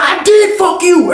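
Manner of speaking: very high, drawn-out shout; hammed-up taunt; acted yelled comeback. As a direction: land the last blow, stretched out and high. Acted climax of shouting match.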